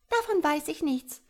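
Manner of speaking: high, loud carefree little voice; cheerful tadpole. A narrator's reading. Tadpole shrugs off mother's lecture, cheerful high voice. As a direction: brush it off merrily, pitched high. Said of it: "fächelte vergnügt".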